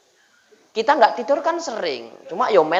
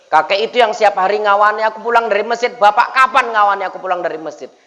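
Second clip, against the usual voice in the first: raised, more forceful reproach; child's insistent protest; 2 semitones higher than usual. Acted. Son confronting father about coming home from mosque, insistent. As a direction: press the accusation home as the child. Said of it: "sebelum anak protes".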